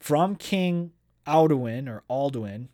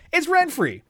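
high excited squeal; 14 semitones higher, 4 dB louder than usual. Real, not acted. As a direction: burst out thrilled at the reveal. Excited reveal after reading.

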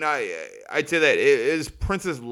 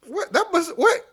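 surprised. Real, not acted. Surprised at the Irish politics.

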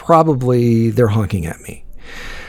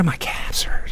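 hushed and breathless, higher pitch; whispered question; 4 semitones higher, 6 dB quieter, slower than usual. Retold or imitated. Waking up sore and wondering aloud, in a whisper. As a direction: whisper it to yourself, puzzled.

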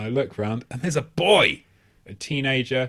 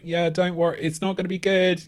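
half-hearted, higher-pitched attempt to dissuade; useless pleading; 7 semitones higher than usual. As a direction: chime in weakly with token warnings. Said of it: "even less usefully than me".

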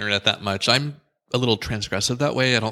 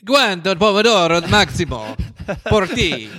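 high, loud mock Italian; fake Italian accent; 9 semitones higher, 4 dB louder than usual. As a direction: ham up a fake Italian question. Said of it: "as we'd say in fake Italian".

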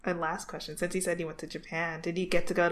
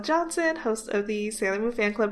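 bright, louder host sign-off; podcast outro; 3 semitones higher, 6 dB louder than usual. Acted. Host signing off the podcast, upbeat announcer read. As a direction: wrap up the show like a host.

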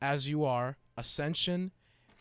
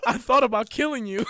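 higher and louder, shaking with laughter; laughing loud; 7 semitones higher, 9 dB louder than usual. Real, not acted. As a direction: barely get it out through big laughs. Cracking up reading list.

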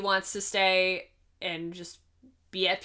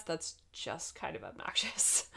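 whispered aside; 3 semitones lower, 10 dB quieter than usual. Real, not acted. Muttered aside about Dory.

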